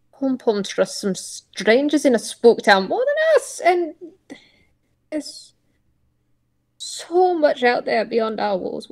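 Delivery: cling to hope, pitch rising; lightly voiced pleading old tortoise.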